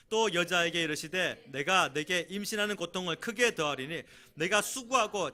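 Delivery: loud, high proclamation of scripture; solemn booming Bible reading. Acted. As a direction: read the verses like a herald. Reading scripture aloud to congregation.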